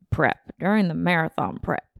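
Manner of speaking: a light put-on accent for a fitness YouTuber's word prep; Nick Bare accent. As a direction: slip lightly into a borrowed coach-like accent on prep. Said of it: "I don't know why I gave him that accent".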